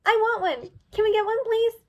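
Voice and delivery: high, pleading filly voice; begging. A narrator's reading. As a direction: beg sweetly, pitch climbing. Misty begging.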